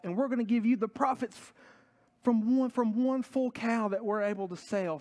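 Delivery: high-pitched, overjoyed gushing thanks; ecstatic prayer. Acted. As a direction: gush thanks to God in an excited high voice. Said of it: "they are ecstatic".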